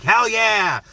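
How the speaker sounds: high, loud whoop of approval; triumphant cheer. Acted. Viewer cheering as she shoots him, triumphant.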